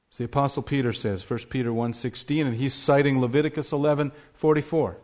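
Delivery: ordinary delivery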